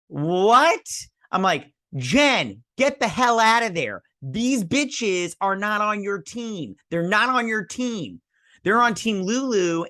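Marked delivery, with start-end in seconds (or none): 0.1-0.9 high, loud disbelieving what; stunned outburst; shocked what. Retold or imitated. Shocked reaction to friends. 2.0-9.9 high, loud urging at the screen; exasperated yelling. Retold or imitated. Yelling advice at TV character.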